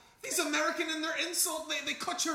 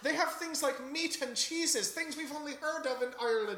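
put-on Irish accent. Irish father explaining America.

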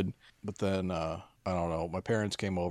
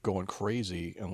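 usual speaking voice

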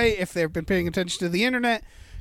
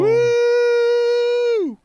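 high loud woo; cheering woo; 6 dB louder than usual. Real, not acted. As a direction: cheer like a crowd. Cheering donor.

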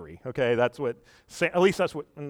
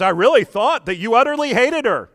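high, loud, anxious father; the frightened father-in-law blurting it out; nervous father-in-law; 9 semitones higher, 9 dB louder than usual. Retold or imitated. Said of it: "her father says".

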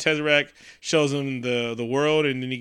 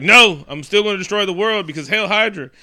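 loud, higher hammed-up declaration; mock villain defiance; 4 semitones higher, 6 dB louder than usual. Skull refusing to give up his plan, mock defiant shout. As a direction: proclaim it like a cartoon villain.

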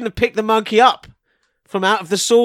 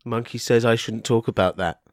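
very low, deadpan; odd artist with monkey; 11 semitones lower than usual. Pretend. Artist refusing the interviewer's question, low and solemn. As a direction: pass on the monkey's word in a low voice.

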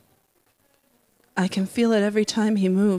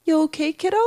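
higher-pitched teacher checking on a student; lightly voiced teacher, rising into a question; teacher's concerned question; 6 semitones higher, much faster than usual. A narrator's reading. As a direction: ask it up high, as a teacher to a kid. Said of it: "when she asks".

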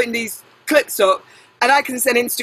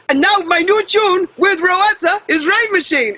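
lightly voiced DJ introducing a track, pitched up; a hint of higher, proclaiming DJ voice; imitating DJ announcement; 7 semitones higher than usual. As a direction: mimic a DJ's big tune intro. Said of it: "now, now he goes".

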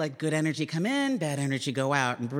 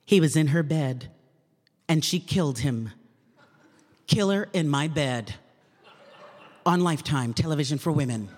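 promo voiceover read; 2 semitones lower, 3 dB louder than usual. Acted. Voice actor reading the network promo in booth, dramatic announcer voice. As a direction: sell the TV movie like a dramatic promo. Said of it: "I'd start reading her scripts".

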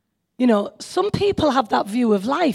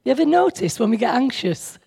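usual speaking voice

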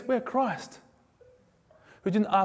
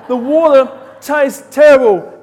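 slower, much louder moaning complaint; whiny grumble, retaken louder; 6 semitones higher, 14 dB louder than usual. Retold or imitated. Israelites whining about desert water, loud slow moan. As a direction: wail it like a spoiled crowd. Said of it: "Can I say sucks?".

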